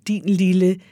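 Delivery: plain reading voice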